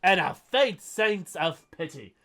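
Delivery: theatrical critic reading with mounting outrage; mock dramatic review reading. Podcaster reading a viewer's harsh review, theatrical. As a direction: read the scathing review like a pompous critic.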